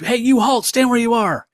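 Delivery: high, loud guard's command; comic-book guard yelling halt; guards shouting. Acted. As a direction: bark the order to stop at him. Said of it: "these guards come up".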